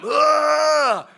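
loud, high, long mock battle yell; charging scream. Hired hand runs at horses, acted scream. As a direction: let out a drawn-out comic war cry. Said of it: "do not chase six horses and scream at them".

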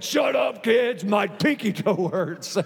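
hammed-up shout, slowing on the complaint; mock-angry hurting dad; acted pained outburst. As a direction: snap at the kids through comic pain. Acts hurt dad snapping at kids.

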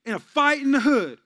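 lightly voiced bragging tough guy. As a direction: brag like a wannabe street guy. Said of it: "some people that be like".

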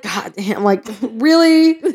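laughing exasperated really; drawn-out disbelieving really. Retold or imitated. Exasperated inner voice.

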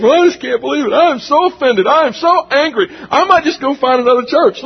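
high, whiny mock outrage; offended churchgoer. Pastor mocking a member offended by discipline, whiny. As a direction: parody a huffy member storming off. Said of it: "somebody goes".

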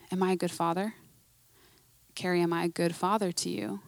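soft, hushed fatherly question with a rising end; gentle voice of God. Retold or imitated. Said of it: "the Lord just reminds me".

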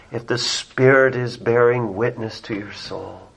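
low, softened, grave delivery; solemn preaching. Real, not acted. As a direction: bring it down to a quiet, reverent register.

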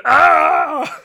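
sudden high, loud pained cry; agonized scream. Retold or imitated. Imitating Lorca screaming in the agony booth. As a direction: yell out like a man being tortured. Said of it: "Just going".

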